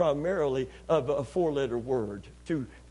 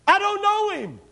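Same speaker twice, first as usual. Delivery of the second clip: much higher and louder, cried out; panicked denial; 13 semitones higher, 7 dB louder than usual. Acted. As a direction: blurt it out in panic. Peter's panicked denial acted out.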